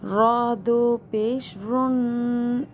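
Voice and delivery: slow drawn-out spelling drill; recitation drill. Shown as a demonstration. Quran teacher drilling students through the letters slowly. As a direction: stretch each syllable with nasal ghunna.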